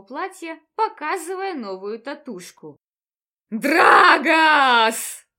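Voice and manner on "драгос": roaring the name with rage; furious roar. Acted. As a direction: bellow it out in fury. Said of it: "гневно взревели три голоса". (гневный рёв)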